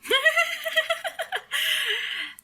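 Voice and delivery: very high squealing laugh; long burst of high-pitched laughter; real laughter. Real, not acted. Amused by friend's blunt phrase.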